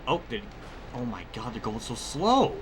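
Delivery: usual speaking voice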